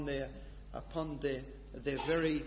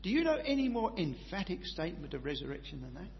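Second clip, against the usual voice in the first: ordinary delivery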